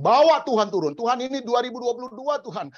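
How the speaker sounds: high, loud, demanding prayer; preacher parody shouting at heaven, pitched high; mock pushy preacher. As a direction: parody a preacher ordering God down. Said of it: "kata-kata hamba Tuhan".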